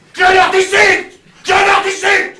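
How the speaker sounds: loud, high, defiant cry of Sud; mock shout. Defying southern boasters, a loud mocking shout. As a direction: fling the word Sud back at southern boasters.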